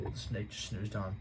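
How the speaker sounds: plain reading voice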